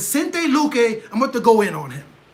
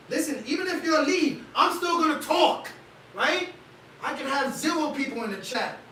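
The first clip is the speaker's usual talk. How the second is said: pitched way up, all fired up; higher and pumped, hyping the chat; hyping up an empty chat, pitched high; hyped up; 5 semitones higher, 4 dB quieter than usual. Real, not acted. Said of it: "Why am I yelling?".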